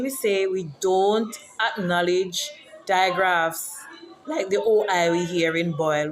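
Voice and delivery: normal talking voice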